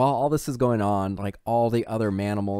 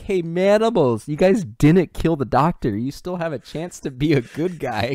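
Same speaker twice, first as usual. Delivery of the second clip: calling out; 5 semitones higher, 8 dB louder than usual. Retold or imitated. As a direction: shout it across the compound. Braddock calling out over the gate.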